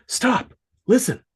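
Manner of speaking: sharp, high alarmed call; a frightened warning shout. Acted. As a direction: freeze them with a hushed alarm. Freddy alarmed in script read.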